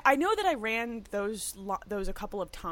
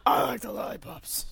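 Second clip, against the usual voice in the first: creeper voice; 2 semitones higher than usual. Real, not acted. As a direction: say it like a lurking creeper. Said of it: "It's my creeper voice".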